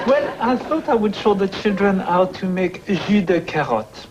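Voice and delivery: put-on English with a thick French accent; a French chef explaining to children in a French accent. TV chef tells children his recipe, French accent. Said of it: "I love that French accent".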